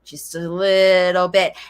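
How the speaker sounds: put-on low, sighing child's plea to mommy; sigh, then plead in a low voice; weary little appeal, pitched low; late talker's plea. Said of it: "it means your late talker says".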